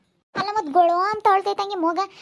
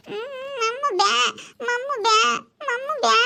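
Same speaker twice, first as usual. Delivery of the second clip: whiny crying refusal; 7 semitones higher than usual. Real, not acted. Toddler whines through tears at being fed. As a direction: cry out no to the food.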